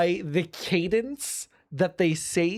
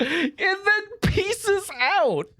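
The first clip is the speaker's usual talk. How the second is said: very high, slow, drawn-out squeal; high-pitched, lingering echo; gleeful echo; 13 semitones higher, much slower than usual. Real, not acted. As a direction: stretch it out high in comic disbelief. Echoes it in exaggerated delight.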